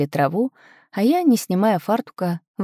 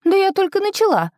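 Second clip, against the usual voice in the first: laughing; 13 semitones higher, 3 dB louder than usual. Acted. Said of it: "рассмеялась я".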